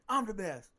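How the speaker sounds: loud, high declaration; imitated self-hype. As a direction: chant it like a fighter psyching up. Quoting fighter's chant during introductions.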